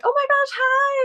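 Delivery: high-pitched gushing hello; thrilled, high greeting, imitated; imitated excited greeting. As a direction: mimic a co-star's delighted squeal of a hello.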